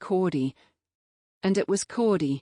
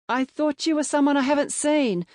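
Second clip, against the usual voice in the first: raised call; calling after; 10 semitones higher, 3 dB louder than usual. A narrator's reading. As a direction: call after her as she leaves. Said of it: "I called after her".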